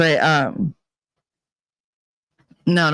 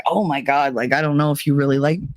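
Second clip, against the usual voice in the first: normal talking voice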